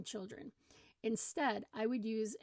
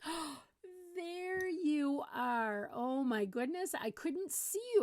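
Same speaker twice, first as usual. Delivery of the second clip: high, drawn-out delighted discovery; pretend surprise; 7 semitones higher, slower than usual. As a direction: gush as if the lost child just appeared. Acted surprise at finding the child.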